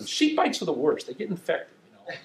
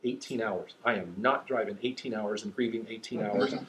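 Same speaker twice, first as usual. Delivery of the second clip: lower, much softer thought; inner thought; 7 semitones lower, 9 dB quieter than usual. Retold or imitated. Dad thinking about the long drive, lower and much softer. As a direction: mutter it to yourself in dread. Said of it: "And I'm thinking".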